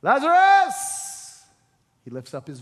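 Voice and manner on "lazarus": long, high, loud commanding call; Jesus shouting into the tomb. Acted. Jesus calls dead man forth.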